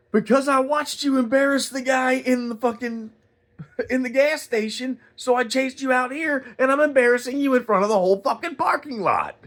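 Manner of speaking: high, loud public dressing-down; re-enacted yelling. Man embarrassing customer before whole parking lot, yelling. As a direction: shame him in front of everyone, pitch way up. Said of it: "I was yelling at him like that".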